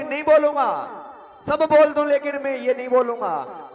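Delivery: loud, high stubborn refusal; the stubborn brother shouting back at the sadhu, loud and high; defiant shouting. Acted. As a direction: yell it back, refusing to give in. Brother stubbornly refuses while beaten.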